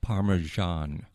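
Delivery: Parmesan said slowly as "par-muh-ZHAHN"; corrected pronunciation. Shown as a demonstration. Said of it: "how would you say it then".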